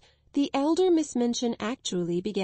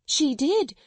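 higher and louder, rising at the end; hysterical insistence; 7 semitones higher, 5 dB louder than usual. Acted. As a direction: press the point, near breaking. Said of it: "her hysterics got the better".

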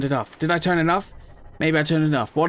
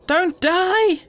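higher, pleading plea to a dying friend; sad pleading; 11 semitones higher, slower than usual. Real, not acted. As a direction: beg it not to die, half joking. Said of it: "I'm actually really sad".

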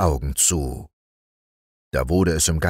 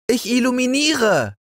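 very high, proud little cat voice; cat calling down. Acted. Little cat calling down to the boy, high proud voice. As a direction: show off like a small cat. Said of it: "rief die kleine Katze herunter".

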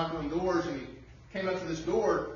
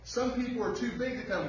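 usual speaking voice

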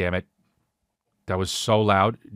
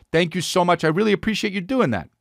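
polite thanks; 7 semitones higher than usual. Retold or imitated. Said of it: "I say to Princess Peach".

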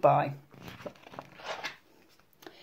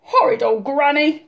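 higher grumbling wolf; sulky wolf growl; 8 semitones higher, 4 dB louder than usual. A narrator's reading. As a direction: growl it with a sore nose. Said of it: "wolf growled, rubbing his nose".

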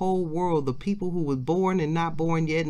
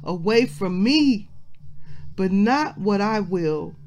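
higher, stronger pleading prayer; impassioned preaching; 3 semitones higher than usual. Acted. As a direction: plead to the Father from the pulpit. Voices Jesus praying, preaching force.

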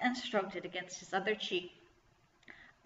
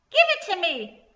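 loud, high-pitched squawk; goblin squawk; 10 semitones higher, 10 dB louder than usual. A narrator's reading. Goblin to Kirsty as she pulls yarn back, squawked. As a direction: squawk the demand like a greedy goblin. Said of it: "the goblin squawked".

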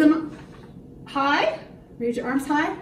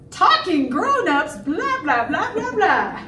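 swooping, raised babble; chattering grown-ups; 4 semitones higher than usual. Acted. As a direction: mimic grown-ups prattling on. Mimics chattering grown-ups in story.

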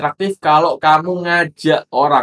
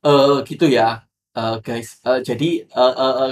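hesitant speech full of fillers, stumbling on every eh; mock a nervous host who can't get the words out; a hammed-up stammer of eh, eh, eh; halting filler-laden demo; slower than usual. Shown as a demonstration. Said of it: "kayak ngomongnya kayak".

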